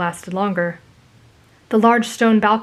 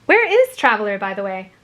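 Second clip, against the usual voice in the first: curious. A narrator's reading. Girl asks where his date went, curious. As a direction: ask with perky curiosity. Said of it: "she inquired curiously".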